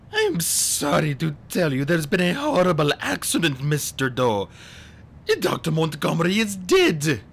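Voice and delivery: put-on mournful villain voice; feigned sorrow. A narrator's reading. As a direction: break bad news with fake sorrow. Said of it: "too sad to continue".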